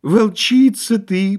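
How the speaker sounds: slow, high moaned insult; drawling whine. A narrator's reading. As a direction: stretch it into a long wail. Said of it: "в том же тягучем тоне".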